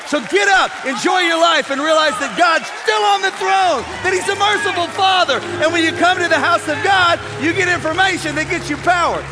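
shouted high, loud exhortation; roaring pulpit shout to the church; full force preaching. Acted. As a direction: preach it to the rafters.

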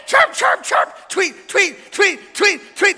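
loud, high-pitched birdsong imitation; hammed-up bird calls; noisy excited bird. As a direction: chirp away like an overjoyed bird. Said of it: "He's making all this noise".

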